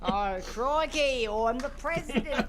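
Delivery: hammed-up Australian accent as a president; Australian JFK impression. Joking on a podcast, an Aussie JFK in a hammy Australian accent. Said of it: "Australian John F Kennedy".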